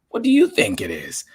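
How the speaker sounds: usual speaking voice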